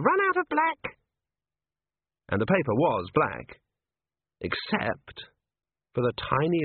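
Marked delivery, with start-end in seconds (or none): 0.0-1.0 squeaky imp. A narrator's reading. Imp character voice.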